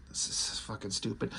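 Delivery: very low, barely voiced mutter; muttered aside. Real, not acted. Reviewer fed up with the comic, muttering disgustedly. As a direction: grumble it under your breath.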